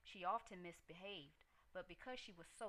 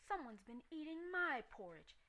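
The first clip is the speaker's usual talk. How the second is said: higher mama bear voice, a bit louder; Mama Bear yelling; 8 semitones higher, 4 dB louder than usual. A narrator's reading. Mama Bear finds her porridge touched, higher mock yell. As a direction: voice a fussed mother bear, raised. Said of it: "yelled the Mama Bear".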